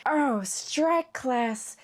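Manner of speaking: slow, drawn-out celebrity impression; hammed-up Coolidge-style drawl; Jennifer Coolidge. Shown as a demonstration. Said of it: "giving more Jennifer Coolidge rather than Hiccup".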